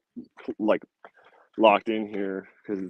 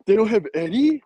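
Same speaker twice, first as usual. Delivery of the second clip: high, louder mock gasp of a question; imitating a scandalized Hong Konger; mock shocked local; 12 semitones higher, 7 dB louder than usual. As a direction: ham up their horror, pitched high. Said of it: "I'm like".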